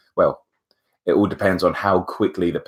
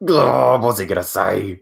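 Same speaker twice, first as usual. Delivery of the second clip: mock dread; 3 semitones higher, 4 dB louder than usual. Waiting through the interviewer's dramatic pause, mock dread. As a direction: play up the nervous anticipation.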